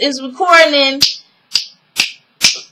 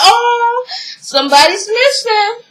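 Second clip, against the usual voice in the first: higher, crying whine of sympathy; sad cry; 8 semitones higher than usual. Real, not acted. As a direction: whimper it like you're about to cry. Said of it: "speaker's own sad reaction".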